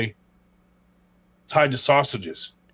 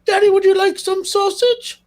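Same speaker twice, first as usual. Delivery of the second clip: put-on very high squeaky kid voice, louder; high-pitched mock kid asking daddy; imitating Freddy Got Fingered; 5 dB louder than usual. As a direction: squeal it like a goofy man-child. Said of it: "What the fuck was that".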